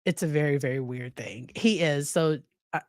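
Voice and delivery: normal talking voice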